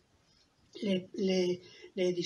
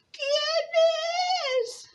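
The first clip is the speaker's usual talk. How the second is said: grandmother. Acted. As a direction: call out feebly high, drawing it out. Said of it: "la abuelita desde su cama le dijo".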